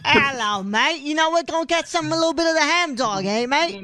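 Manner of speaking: badly faked, lilting Aussie accent; attempted Australian accent. Host hammily plays an Aussie after a ham dog, fake Australian accent. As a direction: put on a clumsy Australian accent. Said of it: "I can't do an accent".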